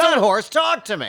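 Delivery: high, loud gleeful shout; excited shout. Real, not acted. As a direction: exclaim with delight. Excited reaction to the horse bit.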